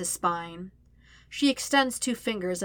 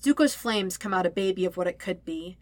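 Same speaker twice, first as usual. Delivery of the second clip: ordinary delivery, nothing marked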